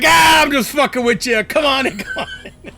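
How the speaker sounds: loud, high, laughing welcome; laughing shout. Retold or imitated. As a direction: boom out the joke and laugh through it. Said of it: "he's like".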